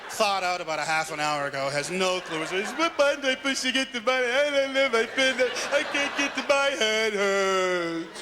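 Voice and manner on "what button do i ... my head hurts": high, loud, bewildered caveman; dim caveman. Acted. Clueless caveman at the bank machine, bewildered wail. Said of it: "Gorgo the caveman just thought out".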